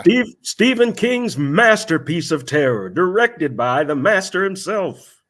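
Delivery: mock-epic trailer voice; trailer announcer. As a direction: hype it like a cheesy trailer. Reading trailer copy aloud.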